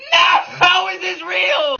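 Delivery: high, slowed, yelled in disbelief; incredulous shout. Real, not acted. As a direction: scream it, can't believe it. Disbelief at monkey club.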